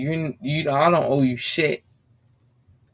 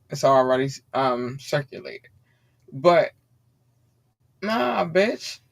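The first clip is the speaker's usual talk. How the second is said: normal talking voice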